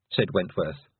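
ordinary delivery

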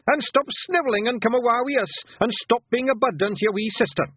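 high, loud Scottish scolding; exasperated wee Scotsman yelling; shouting. A narrator's reading. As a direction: tell off a snivelling bairn at a shout. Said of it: "shouted Rob Anybody".

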